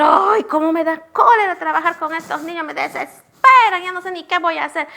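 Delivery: high, loud fed-up rant, speeding up at the end; exasperated teacher. Retold or imitated. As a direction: vent like a fed-up teacher at the end of her rope. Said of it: "No podemos decir".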